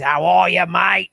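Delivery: hammed-up Aussie greeting; Australian accent. Shown as a demonstration. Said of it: "the Aussies".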